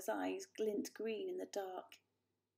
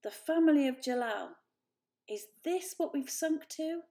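bitter; 6 semitones higher, 6 dB louder than usual. A narrator's reading. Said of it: "croaked the elder Poor".

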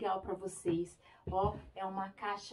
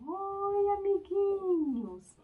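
cartoon princess; 11 semitones higher, 7 dB louder, much slower than usual. Frog puppet greets the children.

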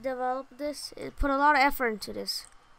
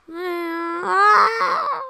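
startled shout; 7 semitones higher, 6 dB louder than usual. Real, not acted. Startled in game.

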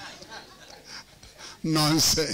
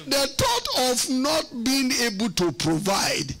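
slow and higher, words stretched; emphatic, amused; 4 semitones higher than usual. Real, not acted. Preacher on failing to provide, slow amused emphasis. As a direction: spell it out slowly in a raised pitch. Emphatic point between laughs.

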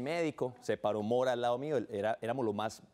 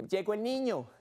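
high-pitched mock fanfare; hammed-up high family welcome; proud announcement; 10 semitones higher than usual. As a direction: announce the darling son's arrival. Imitates family announcing his arrival.

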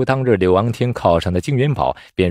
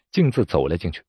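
ordinary delivery

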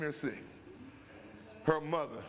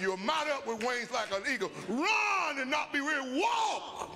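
preaching at full force; 9 semitones higher than usual. Real, not acted. Pastor preaching the eagle verse to the saints. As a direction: ring out the verse like a climax.

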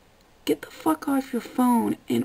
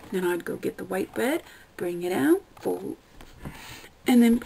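normal talking voice

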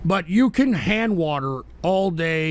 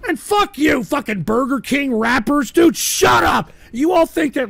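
high, loud furious yelling; furious shout; 8 semitones higher, 6 dB louder than usual. Real, not acted. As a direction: scream it at the rivals. Raging at rival streamers.

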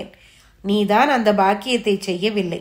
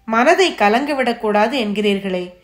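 usual speaking voice

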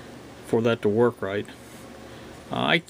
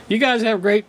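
hoarse voice giving out; losing your voice mid-sentence; voice cracking; 8 semitones higher, 7 dB louder than usual. Real, not acted. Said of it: "now I'm losing my voice".

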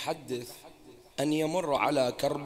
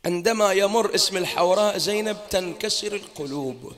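full-force preaching; 3 semitones higher, 5 dB louder than usual. Acted. Preacher extolling Zaynab to the mourners, loud impassioned preaching. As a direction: preach it out to the hall with fervour.

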